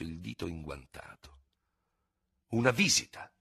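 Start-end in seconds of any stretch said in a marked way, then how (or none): 2.5-3.3 higher-pitched exclamation of disbelief; lifted, incredulous outburst; exclaiming. A narrator's reading. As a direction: exclaim it, taken aback. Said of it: "esclamò".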